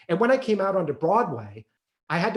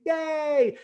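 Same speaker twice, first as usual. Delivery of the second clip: excited cheer; 12 semitones higher than usual. Real, not acted. Cheering the album cover.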